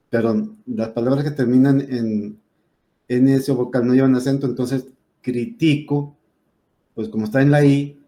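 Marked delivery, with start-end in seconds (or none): 5.2-6.2 critico said slowly as cri-TI-co; stress on middle syllable. Shown as a demonstration. Teacher reading the word without written accent, critico stressed on TI. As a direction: move the stress of critico onto TI. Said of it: "debe de ser una palabra grave".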